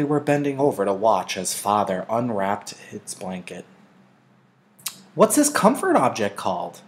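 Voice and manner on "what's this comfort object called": put-on high, curious little-girl voice; a child asking with bright curiosity; young girl Lily. A narrator's reading. Lily asks her father about the toy, curious. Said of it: "Lily asked".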